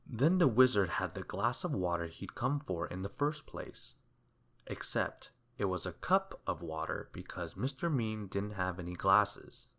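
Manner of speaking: plain reading voice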